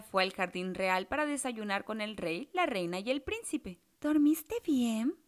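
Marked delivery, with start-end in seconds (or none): 4.0-5.2 slow, sweetly asking queen. A narrator's reading. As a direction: ask slowly and sweetly. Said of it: "le preguntó la reina".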